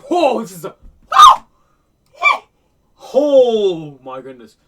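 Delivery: loud, high whoa of shock; blown-away reaction; astonished exclamation. Real, not acted. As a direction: cry out, drawing the words out in amazement.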